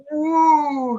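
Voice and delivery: high, loud wail of people sliding away; imitated crowd whoa. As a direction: whoop like passengers flung across a room. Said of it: "all the people on them go".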